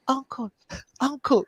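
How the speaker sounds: put-on high, soft, weak kid voice; dying alien child. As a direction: call out weakly like a hurt little child. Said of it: "He's like".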